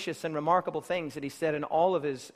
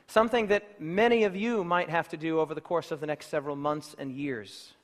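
ordinary delivery

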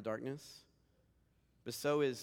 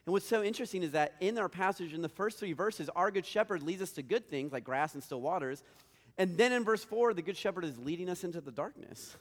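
raised, louder preaching voice; 6 semitones higher, 5 dB louder than usual. Acted. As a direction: build it up from the pulpit. Preaching with rising force.

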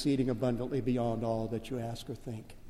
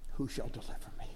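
whispered lament; 4 semitones lower, 10 dB quieter, faster than usual. Acted. Quoting Paul's lament.

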